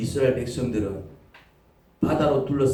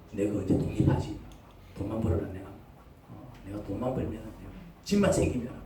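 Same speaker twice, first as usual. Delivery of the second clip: muttered grumble; 6 semitones lower, 9 dB quieter than usual. Retold or imitated. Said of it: "부모 쳐다보고 있으면요".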